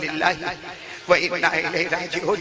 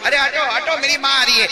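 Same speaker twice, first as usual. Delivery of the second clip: higher, louder call to clear the way; raised, insistent voice; acted urgent command; 6 semitones higher, 5 dB louder than usual. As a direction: act a son urging the crowd aside. Son clearing crowd for mother.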